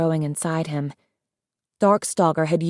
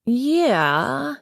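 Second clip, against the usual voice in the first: drawn-out, higher, dipping and rising; slow, wondering agreement; slow realisation; 6 semitones higher than usual. A narrator's reading. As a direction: stretch it out as the idea sinks in. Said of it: "Lionfish said slowly, as though he hadn't thought".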